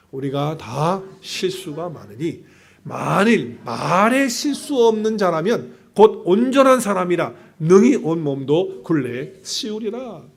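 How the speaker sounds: reading scripture aloud, slow and measured. Acted. Preacher leads the congregation through the verse, slow. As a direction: let each phrase of the verse land slowly. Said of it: "시작".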